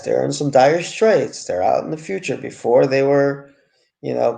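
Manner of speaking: usual speaking voice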